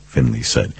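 usual speaking voice

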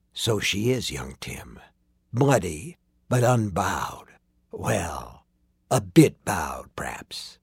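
low, hushed villain, trailing into near-whisper; wry Covenant man. A narrator's reading. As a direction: toy with the boy, slow and soft. Covenant man's wry reply.

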